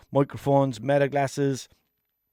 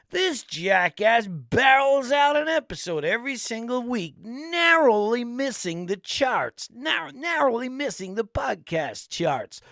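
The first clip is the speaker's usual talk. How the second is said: high, slow dramatic announcer voice; mock police-chase narrator, drawn out; impression of him; 9 semitones higher, slower than usual. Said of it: "He'd be like".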